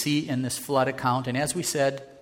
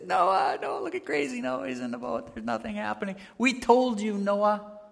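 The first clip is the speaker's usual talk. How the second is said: high jeering sing-song calls of Noah; mocking crowd; 9 semitones higher than usual. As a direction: taunt him like a scoffing neighbor. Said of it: "really mocking Noah".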